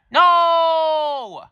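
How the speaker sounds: high, loud, drawn-out No; shrill protest. Acted. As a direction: wail it like a caught brat. Angelica protests dad call.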